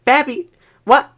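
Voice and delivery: high, loud shrill-free scolding, laughing at the end; mock angry scold. Manager scolding the coworker for standing around, loud mock scold. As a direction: berate him like an annoyed boss.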